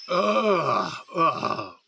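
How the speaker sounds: groggy groan. Acted. Waking up confused after explosion.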